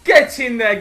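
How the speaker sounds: rising into a loud, high shout; excited shout. Real, not acted. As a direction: yell it as the striker runs through. Commentating his own counter-attack.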